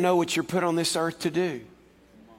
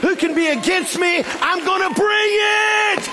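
rousing defiant declaration; 7 semitones higher than usual. Acted. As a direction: rise up and declare it, climbing to a full shout. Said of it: "rise up and say".

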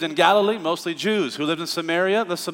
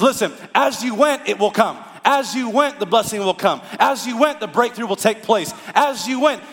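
preaching; 5 semitones higher than usual. Acted. Voicing God's promise, rhythmic.

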